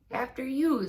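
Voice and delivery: very high and loud, courteous; polite hesitation. Acted. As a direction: offer the way with exaggerated politeness. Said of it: "politely hesitating".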